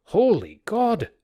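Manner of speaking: high, loud, slow cry of terror; terrified cry. Acted. Sailor seeing the ghost in the porch, terrified cry. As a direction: shout it out in fright. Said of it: "cried the sailor".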